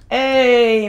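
usual speaking voice

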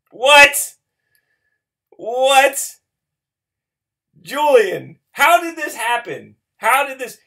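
loud, high disbelieving yells; yelling in shock at the pull; stunned shouting. Real, not acted. Pulled a rare card, shocked.

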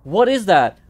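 high frightened yell; real alarmed cry; scared shout. Real, not acted. Sees creature in game.

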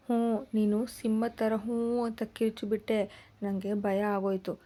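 lightly voiced little girl, pouting; a hint of a sulking small child. A narrator's reading. Little girl tells her father she got scared, lightly voiced pout. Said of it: "ಎಂದಿತು ಮೂತಿ ಉಬ್ಬಿಸಿ".

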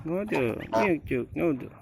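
high, gentle cooing voice; talking to goslings. Real, not acted.

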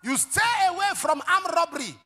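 high, loud preacher's cry; preaching shout. Real, not acted. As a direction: drive the warning home to the church. Preaching at full force.

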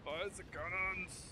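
high, soft, drawn-out "fire the guns"; terrible mock command. Player echoes teammate's order to fire, high put-on voice. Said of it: "Oh, that was terrible".

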